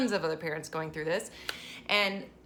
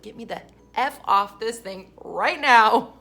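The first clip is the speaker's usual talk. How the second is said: loud, drawn-out insistence; exaggerated fed-up outburst; mock desperate demand; 4 semitones higher, 12 dB louder, slower than usual. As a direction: demand off the ride, hammering the last words. Acting desperate roller coaster rider.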